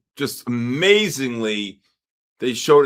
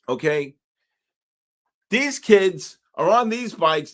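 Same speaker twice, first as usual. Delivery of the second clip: worked-up rant; 8 semitones higher than usual. Real, not acted. To his friend about reckless teen bikers, a worked-up rant. Ranting about kids on e-bikes.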